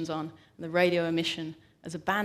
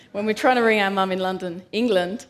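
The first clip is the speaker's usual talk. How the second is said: louder, stressing London, England; louder emphatic restatement; 7 dB louder than usual. Shown as a demonstration. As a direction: say "London, England" louder, pressing each word.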